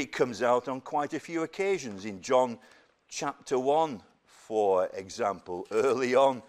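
ordinary talk

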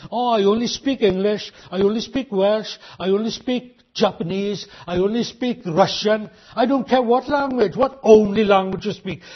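normal talking voice

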